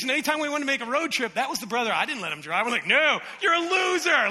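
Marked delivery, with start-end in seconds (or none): 2.9-4.3 high, loud, drawn-out put-down; mock scornful rejection. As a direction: jeer it slowly at him. Acting out judging the brother.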